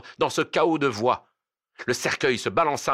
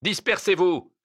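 high, raised command; authoritative shout, pitched up; shouted order; 8 semitones higher, 4 dB louder than usual. Acted. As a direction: cry out to scatter the crowd. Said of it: "cria le juge de paix".

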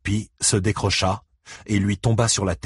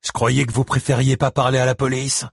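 higher-pitched teasing jibe; joking; 5 semitones higher, 3 dB louder than usual. A narrator's reading. Buddy Lee needling the man about police, joking. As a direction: toss it out as a taunt. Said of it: "plaisanta Buddy Lee".

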